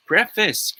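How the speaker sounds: preface said PREF-iss; short e in preface, weak second syllable; correct pronunciation. Shown as a demonstration. Tutor reveals the answer to students, correct pronunciation. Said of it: "It's pronounced as preface".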